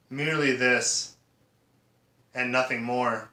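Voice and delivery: usual speaking voice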